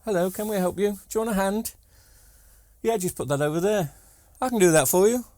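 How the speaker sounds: imitating eager helpers, high lilting voice; really nice staff. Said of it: "they're really nice".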